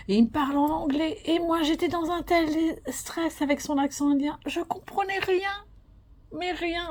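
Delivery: high-pitched, flustered retelling; mock-helpless high voice, overwhelmed; retold stress. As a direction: relive being totally lost, voice climbing high. Reliving stress of not understanding proctor.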